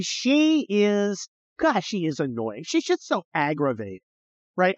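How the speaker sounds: ordinary talk, nothing marked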